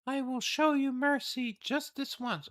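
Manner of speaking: high, grand, condescending lady; noble lady voice. Acted. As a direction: speak down with lofty pity. Reads noble lady's lines.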